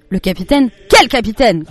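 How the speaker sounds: loud startled questioning; shout. A narrator's reading. Father alarmed to hear of a captain, shouting. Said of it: "cria son papa".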